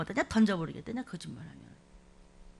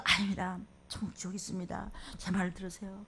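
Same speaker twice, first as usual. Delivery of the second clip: humble pleading; 5 semitones lower, 9 dB quieter than usual. Retold or imitated. Believer voiced pleading softly.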